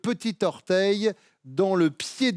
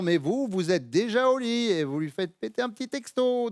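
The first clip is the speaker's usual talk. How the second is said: ordinary delivery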